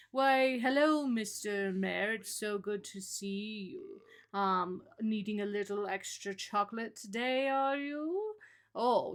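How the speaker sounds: lightly done posh British gentleman voice; a hint of a British toff, sly and smug; mild British accent, smooth and teasing. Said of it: "this guy is so very British that he'll be like".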